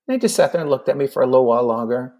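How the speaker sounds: ordinary delivery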